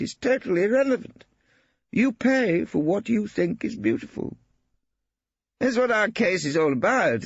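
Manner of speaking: high, jovial, scornful artist; Brittling holding forth. A narrator's reading. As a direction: charm her, mock the painting.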